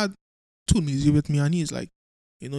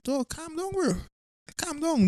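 higher, softer mock soothing; calming down; 9 semitones higher, 7 dB quieter, slower than usual. Pleading with the angry coworker to calm down, soft and higher. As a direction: gently talk him down, hushed. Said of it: "I'm like".

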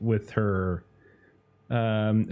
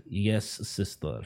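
slow line let out on a breath; exhaled reply; 4 dB quieter, slower than usual. Acted. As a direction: exhale into a slow, measured answer.

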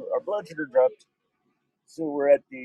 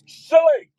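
much higher, louder "Silly!" squeal; shouted silly; 10 dB louder than usual. Shown as a demonstration. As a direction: squeal the word "Silly!" high and loud. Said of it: "They're silly".

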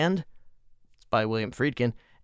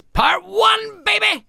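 hyped shout; 10 dB louder, slower than usual. Real, not acted. Excited hype shout.

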